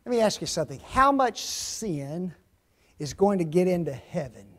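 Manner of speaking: ordinary talk, nothing marked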